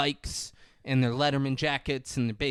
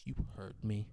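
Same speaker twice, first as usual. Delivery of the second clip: much softer, cool reply; quiet standoff; 5 semitones lower, 14 dB quieter than usual. Retold or imitated. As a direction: hold it low and steady.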